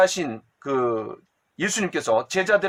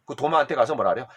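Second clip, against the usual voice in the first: ordinary talk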